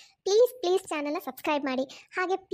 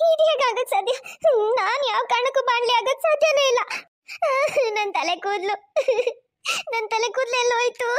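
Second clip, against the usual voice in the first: higher, tearful lament; crying; 5 semitones higher than usual. Acted. Wife mourning her lost hair, tearful crying. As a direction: sob out the disbelief over lost hair. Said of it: "ಅಷ್ಟೊಂದು ಅಳ್ಬೇಡ ಶ್ರುತಿ".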